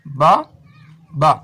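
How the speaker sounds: "बा, बा" said as two detached syllables; split into syllables. Shown as a demonstration. Teacher counting the syllables of an Urdu word, split apart. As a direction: leave a clear gap between "बा" and "बा". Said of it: "इसमें एक रुकन बा है".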